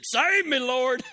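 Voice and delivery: high, loud panicked plea; terrified cry. A narrator's reading. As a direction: yell for help as you sink. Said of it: "he was terrified".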